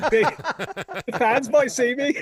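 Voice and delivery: high, put-on anxious voice; mock his fuss over the fans; fretful imitation of Lawrence. Author imitating Lawrence at airport security, high fretful voice. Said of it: "And he said".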